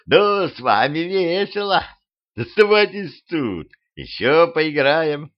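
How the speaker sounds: high, drawn-out teasing voice; gleeful skeleton. A narrator's reading. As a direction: taunt them playfully, slowly and high. Taunting skeleton revels in their fight. (высокий, издевательски весёлый голос)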